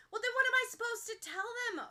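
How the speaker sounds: high, loud exasperated outburst; bursting out. Acted. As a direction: let it burst out like a pipe giving way. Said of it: "Ochako bursts like a bad pipe".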